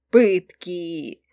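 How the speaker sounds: low, relishing announcement; fervent promise. A narrator's reading. Friend announces her favourite plan to the narrator, fervently. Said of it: "жарко пообещала Келли".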